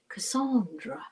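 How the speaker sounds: Cassandra said with a light British accent, a soft falling end. Shown as a demonstration. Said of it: "I want to say it with a British accent".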